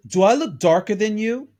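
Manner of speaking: half-acted affronted retort; mock indignant quote. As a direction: toss the question back at him. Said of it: "and she says".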